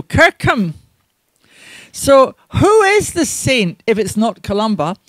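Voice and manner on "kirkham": "Kirkham" as KIRK-um, falling at the end; "Kirkham" said the local way, weak "-um" ending; local pronunciation. Shown as a demonstration. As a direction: say "Kirkham" with a reduced second syllable. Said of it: "modern local pronunciation".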